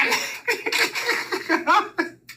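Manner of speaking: silent high laugh; real wheezing laugh; breathy wheezing laugh. Real, not acted. Laughing at the memory.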